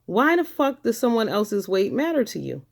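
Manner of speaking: ordinary delivery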